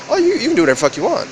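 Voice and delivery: quick, loud mock lawyer voice; animated retold reply. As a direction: rattle it off like an excited lawyer.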